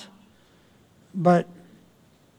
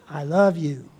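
tender; 3 semitones lower than usual. Shown as a demonstration. Looking spouse in the eyes.

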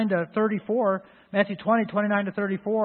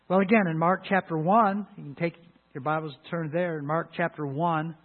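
normal talking voice

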